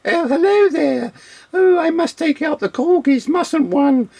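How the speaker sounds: very high-pitched posh upper-class British lady. Acted. Imitating the girl from the tapes, mock upper-class British. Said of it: "she's like your upper class British".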